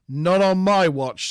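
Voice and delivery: acted commanding defiance; firm refusal. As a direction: draw it out as a captain standing his ground. Acts Picard defying the admiral.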